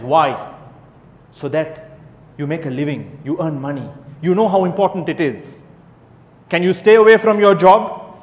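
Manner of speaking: ordinary talk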